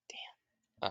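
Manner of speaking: breathy whisper; whispered damn. Retold or imitated. Naruto hearing the dog died, whispered. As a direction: drop to a whisper. Naruto reacts to sad news.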